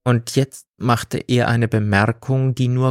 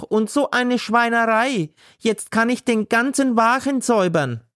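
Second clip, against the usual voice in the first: high, nonstop angry tirade; ranting seller; 13 semitones higher than usual. A narrator's reading. Gailana rants at God about her ruined sausages, high and angry. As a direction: pour out a furious torrent. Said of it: "Gailanas Wortschwall".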